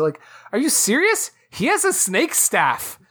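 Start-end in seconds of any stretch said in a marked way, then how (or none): none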